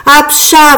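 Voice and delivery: high spelt-out stage sneeze; acted sneeze. A narrator's reading. Frog fairy sneezing mid-dance, acted sneeze. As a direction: burst out with a mock sneeze. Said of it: "voilà que j'éternue".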